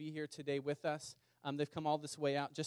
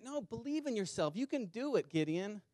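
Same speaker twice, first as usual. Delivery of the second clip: higher, bright cheerleading voice, mocking; mock pep talk; 6 semitones higher than usual. Preacher mocking a self-help God, cheering Gideon on, raised pitch. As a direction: hype him up like a self-help coach, tongue in cheek.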